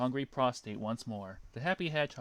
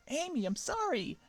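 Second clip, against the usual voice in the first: high, mock-crying apology; Sonic voice. Sonic apologizing to Amy, mock crying. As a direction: whine it out like a fake sob. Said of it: "said Sonic, crying".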